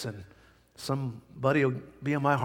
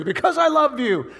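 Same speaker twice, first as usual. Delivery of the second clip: high, loud declaration; 9 semitones higher, 4 dB louder than usual. Acted. Loud climax of the refrain.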